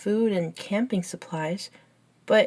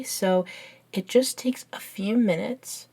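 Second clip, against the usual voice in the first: normal talking voice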